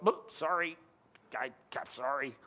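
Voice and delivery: a sheepish mock God apologising; mock apologetic God.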